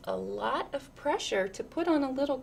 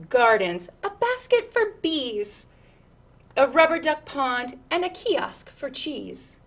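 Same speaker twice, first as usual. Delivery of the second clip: lilting, rhythmic read-aloud; picture book reading; 9 dB louder than usual. Acted. Reading a picture book to children, lilting storyteller voice.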